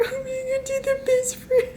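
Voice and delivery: very high, squeaky melting wail; mock-tearful gushing. Reader melting over the child's words, mock-tearful. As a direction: squeal as if about to cry from cuteness. Overcome by the child's cute line.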